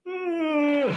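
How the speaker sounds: high drawn-out mock yawn "Mm"; loud yawn groan. The guy behind yawning out loud for hours, a high drawn yawn. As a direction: moan a long high yawn. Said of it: "he's like".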